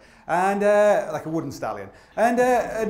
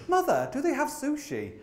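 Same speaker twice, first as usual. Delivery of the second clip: put-on little boy voice; 9 semitones higher than usual. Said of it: "this little boy said".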